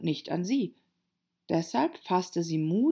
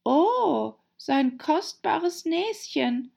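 hoher, gedehnter Schreckensruf; hoch und besorgt aufjammern; entsetzt; 11 semitones higher, 3 dB louder, much slower than usual. A narrator's reading. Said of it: "von Entsetzen gepeinigt". (leicht gespieltes Entsetzen, hoch und gedehnt)

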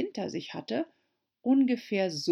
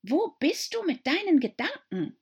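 high, stern mouse; 11 semitones higher, 3 dB louder than usual. A narrator's reading. Mouse to Alice, stern. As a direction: demand where her mind is. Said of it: "sagte die Maus streng".

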